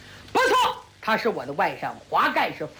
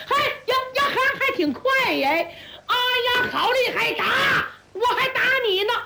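higher, gleeful shouts in the fight; excited fighter; 11 semitones higher than usual. Acted. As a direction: revel in the duel. 朱木英打得兴起. (打得兴起的高声叫喊)

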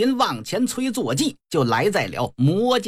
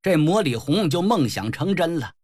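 ordinary delivery

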